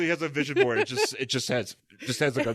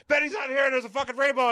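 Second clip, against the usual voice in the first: high-pitched mock panic, bursting in with news; frantic retelling; faster than usual. Acting out Rick bursting in to tell Bruce, mock frantic. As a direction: blurt it way up high, hammed-up alarm.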